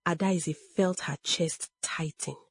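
ordinary delivery, nothing marked

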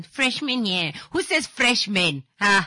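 loud, high incredulous echo; mock disbelief. Host teasing caller for saying freshman in Namibia, mock disbelief. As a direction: throw the phrase back, loud and scandalised.

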